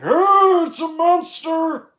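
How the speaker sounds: high, loud slow mock shriek; hammed-up horror scream; mock panic. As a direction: scream like a horror movie victim. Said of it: "Instead of just being all like".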